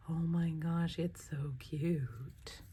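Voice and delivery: soft, drawn-out near-whisper; hushed delight. Real, not acted. Crafter admiring a subscriber's handmade gift, hushed delight. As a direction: let it sink into a slow whispered coo.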